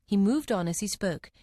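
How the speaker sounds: plain reading voice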